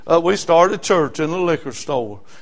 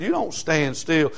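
normal talking voice

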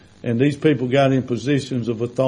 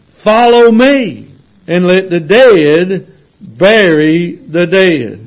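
full-force preaching; 7 semitones higher, 7 dB louder than usual. Acted. Preacher to his church, preaching at full force. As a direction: drive the scripture home to the congregation.

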